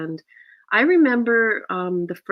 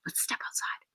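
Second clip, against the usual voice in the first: hushed, whispered invitation; discreet aside; 15 dB quieter than usual. Retold or imitated. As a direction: imitate a low-key, confidential murmur. Imitates co-star's discreet aside.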